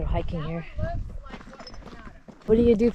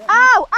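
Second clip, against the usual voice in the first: high, loud pained cry; sharp yelp of pain; 12 semitones higher, 8 dB louder than usual. Real, not acted. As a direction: yelp as it hurts. Hurt in tight passage.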